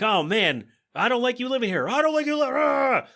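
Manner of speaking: high-pitched burst, then a cry; blurted outburst. Retold or imitated. Frasier blurting his grievance at Martin, high outburst. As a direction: spit it out high and break off. Brothers blurting out their feelings.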